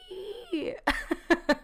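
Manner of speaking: light breathy laugh. Real, not acted. Laughs after her own whisper.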